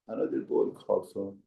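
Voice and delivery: ordinary talk, nothing marked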